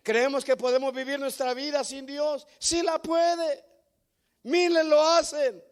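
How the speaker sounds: loud, slow, rising preacher's cry; fiery preaching. Acted. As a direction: thunder it out to the congregation. Preaching climax. (predicador que clama con fuerza a la congregación)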